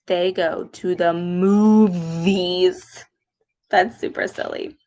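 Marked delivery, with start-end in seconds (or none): 1.1-3.0 "movies" slowed with a long buzz on the v; moo-vvvies, slow and deliberate; middle V held. Shown as a demonstration. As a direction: split "movies" around a stretched v. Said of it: "There's that V sound".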